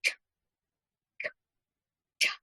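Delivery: tuh as a quick puff of air; tuh sound demo. Shown as a demonstration. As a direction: tap the tongue behind the top teeth for tuh. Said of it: "tap your tongue just behind your top teeth and say".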